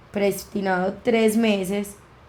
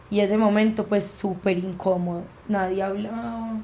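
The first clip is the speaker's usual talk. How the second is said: ordinary talk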